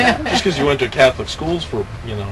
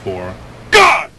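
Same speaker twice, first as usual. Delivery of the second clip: short shout; 2 semitones higher, 7 dB louder than usual. Shown as a demonstration. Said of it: "Read it quietly".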